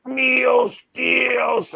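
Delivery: very high, gurgling cry; gurgly dying cry. Retold or imitated. Man shot again, crying out with a gurgle. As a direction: choke it out, gurgling. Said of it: "but more gurgly".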